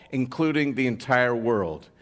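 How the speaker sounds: ordinary talk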